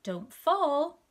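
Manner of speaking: Mister Coco's warning. A narrator's reading. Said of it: "Mister Coco said".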